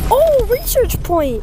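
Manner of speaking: high, loud, drawn-out oh; surprised cry. Real, not acted. Player spots a rare research point, surprised yelp.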